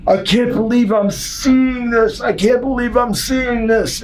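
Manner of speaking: high, slow, drawn-out disbelief; mimicked shock. Maid seeing the half-naked wrestler, shocked. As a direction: imitate a scandalized woman's shocked cry.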